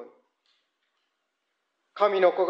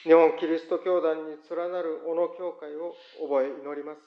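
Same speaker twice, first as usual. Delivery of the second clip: prayer; 4 semitones lower than usual. Acted. Pastor praying for churches before congregation, reverent. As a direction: pray steadily for the church and the world.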